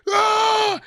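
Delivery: high mock shout of a small kid charging; child's battle cry. Uncle imitates nephew charging at him, high shout. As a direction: yell like a kid running at you. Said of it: "he was like".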